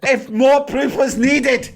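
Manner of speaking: very high-pitched mock ranting; outraged rant. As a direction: imitate a manager crowing in outrage. Retold manager ranting, vindicated.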